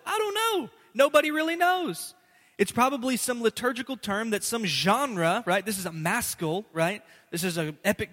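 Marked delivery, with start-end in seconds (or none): 0.1-2.1 much higher put-on voice; comic high-voiced shrug; mock shrug. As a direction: squeak out the not-knowing. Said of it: "I said".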